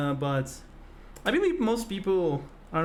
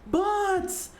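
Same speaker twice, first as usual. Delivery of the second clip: excited greeting; much slower than usual. Real, not acted. Streamer welcoming a returning viewer, excited and high. Greeting a chatter named Bots.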